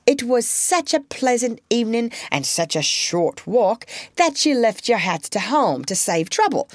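prim polite recitation; polite recited line. A narrator's reading. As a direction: model the polite excuse. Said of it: "Sarah Maud must speak up and say".

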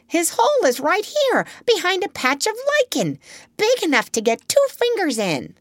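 very high, triumphant calling voice; excited high-pitched holler; shouting down. A narrator's reading. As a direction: call the news down from up high, easing slightly by the end. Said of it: "she shouted down".

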